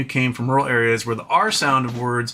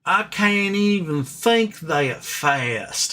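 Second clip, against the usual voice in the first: higher, very slow Southern drawl; teeny lady's Southern drawl; 5 semitones higher, much slower than usual. Retold or imitated. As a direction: stretch every vowel like a Southern lady. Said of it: "this little teeny lady goes".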